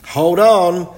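high, loud call to the others. Acted. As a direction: call up to them to hold tight. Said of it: "called Wilf".

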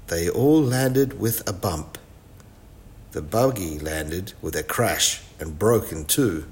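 ordinary delivery, nothing marked

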